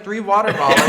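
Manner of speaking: normal talking voice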